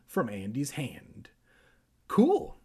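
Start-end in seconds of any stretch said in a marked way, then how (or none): 2.1-2.6 sudden high excited yelp; excited cry. A narrator's reading. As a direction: squeal it out with delight. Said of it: "he cried".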